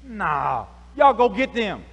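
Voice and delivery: shouted order to the guards; loud command. Retold or imitated. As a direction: bark it at the soldiers, Pharaoh. Pharaoh orders chase.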